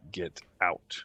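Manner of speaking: barkeep whispering the secret, slow; secretive whisper. Acted. Barkeep lets the travelers in on a secret, whispered slowly. Barkeep shares a dark rumor.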